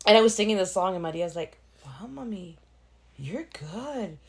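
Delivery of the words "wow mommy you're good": put-on soft, higher little-kid voice; hushed childlike wonder; admiring child. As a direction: imitate an awed small child. Voices her child praising her singing.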